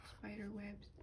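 ordinary talk

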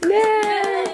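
high, loud cheer of joy; a bright little whoop for a friend; excited cheer. Real, not acted. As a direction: cheer him on with delight. Cheering the new colleague.

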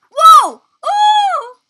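very high, loud startled cries; startled shout. Real, not acted. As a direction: yelp at a near miss. Near crash.

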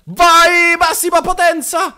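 loud and high, full power; loud hype shout. Real, not acted. Gamer firing the cannon at full power at his rival, loud hype shout. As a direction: shout it as you fire everything.

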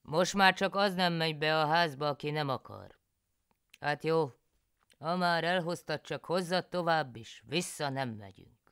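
loud, high country husband; husband János. A narrator's reading. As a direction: play the pleased, resigned husband. János talks to Kati.

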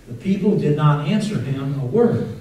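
ordinary talk, nothing marked

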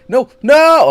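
very high, very loud yell; anguished scream. Real, not acted. Gamer screaming as the chaser catches him. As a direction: scream as you get caught. Caught at last.

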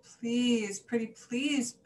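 plead, stretching each please. A narrator's reading. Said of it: "pleaded the mice".

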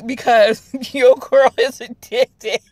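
high, slow words through giggles; laughing admission. Real, not acted. Vlogger thanking a viewer for enabling her, laughing. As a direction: giggle through a sheepish confession.

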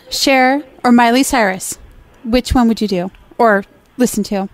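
normal talking voice

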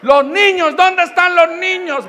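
high, loud mock-panicked call; drawn-out anxious cry, high-pitched; alarmed search. Acted alarm about the children.

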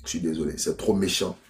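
low, subdued, real dismay; quietly stop, too moved to go on; overcome, giving up. Real, not acted. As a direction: drop right down, apologetic and dejected. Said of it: "je ne peux plus continuer cette histoire-là".

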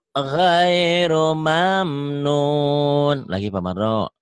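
normal talking voice

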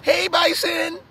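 very high sing-out to an animal; mock tourist calling. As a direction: call the bison in a high, silly voice. Acting tourists petting a bison.

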